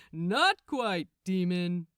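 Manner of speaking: loud, smug archangel voice; smug Gabriel. A narrator's reading. Gabriel greeting the startled demon, loud and smug. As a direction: lord it over the demon.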